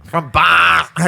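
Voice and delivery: slow, stuffed-nose Boston voice; Boston accent. Shown as a demonstration. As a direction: drag out "From Boston" nasally. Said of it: "talk with a stuffed nose".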